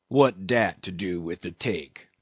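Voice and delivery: low-key testy grumble in dialect. A narrator's reading. Old cook objecting to the mate's question, testy. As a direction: grumble back, mildly irritated.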